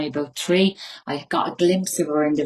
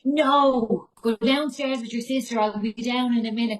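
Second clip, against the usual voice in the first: irritated brush-off; 7 semitones higher than usual. Retold or imitated. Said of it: "And I'm like".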